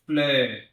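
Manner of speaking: "Fleur" with a rounded eu sound; French fleur. Shown as a demonstration. Teacher repeating "Fleur" for the class. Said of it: "perceba esses dois sons".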